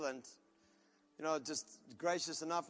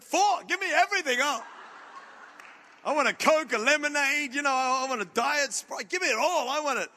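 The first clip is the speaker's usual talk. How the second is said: high, loud, grabbing for everything; a kid demanding every drink on offer; greedy excited shout; 7 semitones higher, 9 dB louder than usual. Retold or imitated.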